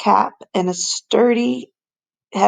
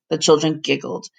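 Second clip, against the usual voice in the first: plain reading voice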